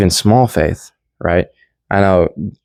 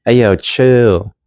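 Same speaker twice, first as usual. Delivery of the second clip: Jesus calming the storm, slow chill; 4 semitones higher, much slower than usual. Retold or imitated. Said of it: "He's like".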